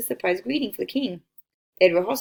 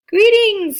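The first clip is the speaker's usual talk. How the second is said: calling out; 12 semitones higher, 10 dB louder than usual. A narrator's reading. Caravan leader calls from afar.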